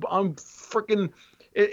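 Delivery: normal talking voice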